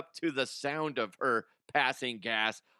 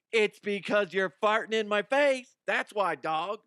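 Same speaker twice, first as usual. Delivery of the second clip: mock scolding; 7 semitones higher, 4 dB louder than usual. Retorting to the dog.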